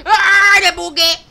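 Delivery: high, very loud yell; screamed cry. Pretend. Player confronting Piggy, screamed cry. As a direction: scream it out long.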